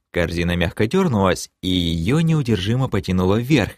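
ordinary delivery, nothing marked

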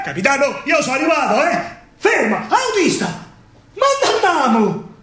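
high, eager announcement; excited homecoming. Acted. Alfredo tells the captain he has arrived, high and eager. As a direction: tell the captain you've arrived.

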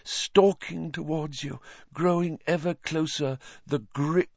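high, slowed doom-laden seer voice; ominous prophecy. A narrator's reading. Trelawney prophesying doom to Harry, high and ominous. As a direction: foretell the doom with relish.

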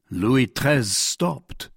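usual speaking voice

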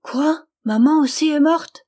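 put-on high, loud little-girl voice; child's shocked cry. A narrator's reading. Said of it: "cria la petite fille".

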